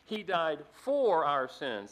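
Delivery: slow and loud, "for" held long; FOR driven home slowly; stress on for. Shown as a demonstration. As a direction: press hard on "for" in "He died for our sins". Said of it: "he emphasized the word "for".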